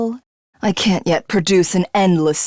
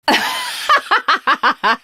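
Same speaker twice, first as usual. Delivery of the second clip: falling villainous laugh; long gloating villain laugh; 12 semitones higher than usual. Acted. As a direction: laugh down in triumph.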